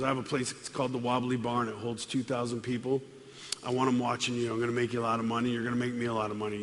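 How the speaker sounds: hammed-up low-key mobster pitch; low club owner. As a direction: pitch him the deal low and confident. Club owner Tuna voiced.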